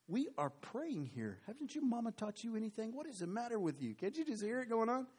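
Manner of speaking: high, indignant scolding. Acted. Praying crowd scolding the girl, high and indignant. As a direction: shame her for interrupting.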